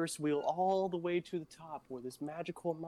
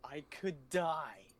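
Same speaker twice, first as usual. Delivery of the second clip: slow punchline; 4 dB louder, much slower than usual. Retold or imitated. Punchline of ghost story.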